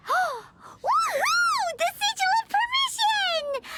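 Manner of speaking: gasp, then a high whooping cheer; delighted shout on spotting the sigil; excited cheer. Acted. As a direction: burst out with joy at the find. Finding the lost sigil in letter.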